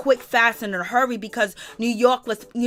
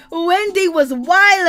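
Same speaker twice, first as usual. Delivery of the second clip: amazed; 8 semitones higher, 7 dB louder, slower than usual. Real, not acted. Amazed recap.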